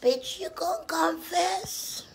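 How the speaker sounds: normal talking voice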